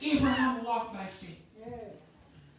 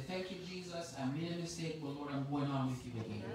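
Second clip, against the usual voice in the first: much softer, lower, hushed thanks; soft humble prayer; 8 semitones lower, 11 dB quieter, faster than usual. Acted. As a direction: murmur it as a confession. Humble prayer after a fall.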